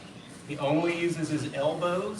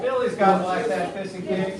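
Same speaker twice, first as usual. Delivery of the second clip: loud, higher cheer; called-out praise; 4 semitones higher, 7 dB louder than usual. Real, not acted. Coach cheers Billy's piston kicks, calling out praise. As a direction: project praise across the room.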